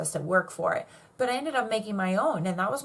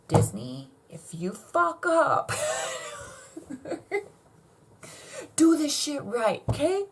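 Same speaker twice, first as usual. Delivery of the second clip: mock warning; 9 semitones higher, 3 dB louder, slower than usual. Real, not acted. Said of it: "warning Disney directly".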